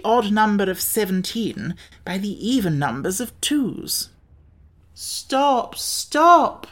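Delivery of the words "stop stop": high, raised cry of protest. A narrator's reading. Pumpkinhead begs the others to stop counting, crying out. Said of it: "cried the Pumpkinhead".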